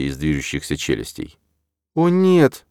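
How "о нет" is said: high, loud alarmed cry; wheezing gasp. A narrator's reading. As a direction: gasp it in dismay. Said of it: "просипела Ирида".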